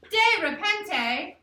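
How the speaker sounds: "De repente" said slow, high and loud, every syllable punched; all caps. Shown as a demonstration. Spanish teacher demonstrating "De repente" to the class, slow and loud. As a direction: belt "De repente" out in capitals, slowly. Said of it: "I did that all capital".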